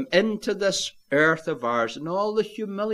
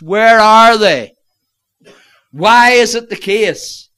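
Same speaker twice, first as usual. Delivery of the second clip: impassioned challenge; 6 semitones higher, 13 dB louder than usual. Real, not acted. Preacher challenging his congregation about worship, impassioned. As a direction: cry the questions out at full force.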